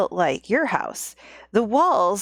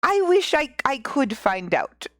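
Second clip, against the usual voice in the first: slow, higher hammed-up Russian accent; terrible Russian accent; 5 semitones higher, slower than usual. Old woman wondering about the pea, in a mock Russian accent. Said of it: "insert my terrible Russian accent".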